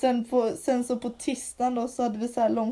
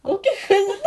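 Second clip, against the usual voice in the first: much higher and louder, through laughter; laughing squeal; 9 dB louder than usual. Retold or imitated. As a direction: squeal the words between laughs. Laughing reenactment of interview.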